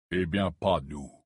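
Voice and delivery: low, curt interruption; cutting. Acted. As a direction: cut him off flatly. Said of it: "coupa Scrimgeour". (d'un ton tranchant)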